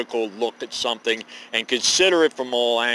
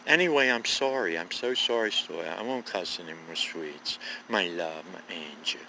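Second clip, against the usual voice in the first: fading to a soft, hushed plea; tender apology; 6 semitones lower, 9 dB quieter than usual. Real, not acted. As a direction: sweet-talk her quietly, dropping lower and softer.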